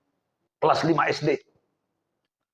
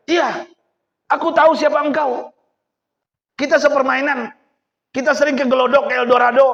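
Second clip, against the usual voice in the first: mocking young man; 7 semitones higher, 4 dB louder than usual. Young man confronting rich businessman.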